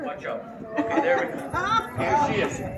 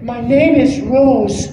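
slow, louder, higher put-on answer; dubbed volunteer voice; 4 semitones higher, 7 dB louder, much slower than usual. Comedian answering for the volunteer, slow put-on voice. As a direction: draw it out in a higher comic voice. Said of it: "what's your name?".

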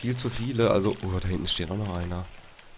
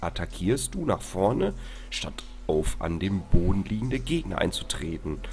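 usual speaking voice

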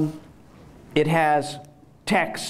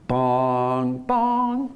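two held chime notes stepping up; rising bong tones; 3 semitones lower than usual. Shown as a demonstration. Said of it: "it goes ... if it goes up".

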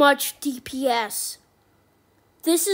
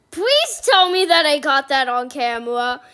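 usual speaking voice

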